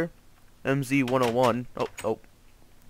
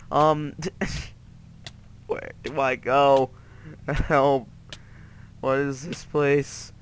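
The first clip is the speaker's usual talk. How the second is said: ordinary talk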